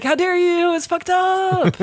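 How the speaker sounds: hammed-up indignation, drawn out slow; mock shocked disapproval; outraged retort. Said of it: "he's like".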